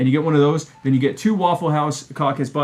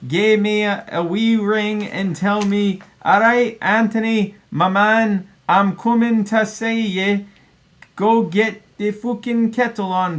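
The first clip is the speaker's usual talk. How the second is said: hammed-up Scottish accent, pitched higher, slow on the drawn-out lines; 8 semitones higher, slower than usual. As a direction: read the note like a broad Highland Scot. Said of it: "it's signed in Scottish".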